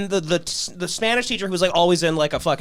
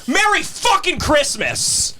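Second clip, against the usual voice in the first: much higher, slow bitter mock greeting; furious sarcasm; 10 semitones higher, slower than usual. Teacher throws cans at the class, bitter. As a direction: spit each word slowly in fury. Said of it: "he goes".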